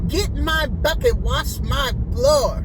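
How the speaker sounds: high loud commanding order; hammed-up bossy client; mock bossy order. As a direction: bark it high and loud, the second half slow. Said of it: "she was like".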